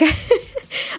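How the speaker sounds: a high airy giggle; high breathy laugh. Real, not acted. Laughing at her own story of the couple. Laugh after the voiced line.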